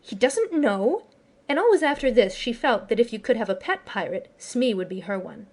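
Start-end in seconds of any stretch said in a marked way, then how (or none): 0.0-1.0 high, slow, shocked disbelief; shocked exclaim. A narrator's reading. Wendy to the pirates, shocked disbelief. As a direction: gasp it out in shock. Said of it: "she exclaimed".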